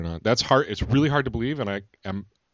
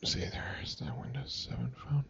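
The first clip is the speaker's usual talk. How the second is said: whisper; 3 semitones higher, 13 dB quieter than usual. Real, not acted. Showing phone, hushed.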